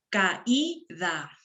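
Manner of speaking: "Caída" slowly split as ca-í-da; "Caída" with the A and I pulled apart; syllable by syllable. Shown as a demonstration. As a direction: draw out each syllable of "Caída". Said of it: "Vamos a silabear".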